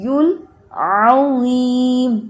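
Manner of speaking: العظیم with heavy ظ, very slow; slow recitation; proper zaa. Shown as a demonstration. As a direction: give the ظ its weight. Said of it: "زیم نہیں پڑھنا".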